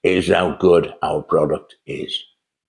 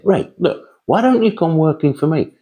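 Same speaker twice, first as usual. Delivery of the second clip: higher, brighter voice for the brother-in-law's offer; brother-in-law offering Ray a company job, voice pitched up; 10 semitones higher than usual. Retold or imitated. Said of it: "And he said".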